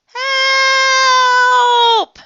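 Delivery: very high, loud, long-held cry; scream for help. Acted. Froggy going over a waterfall, screaming for help. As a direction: scream it and hold it.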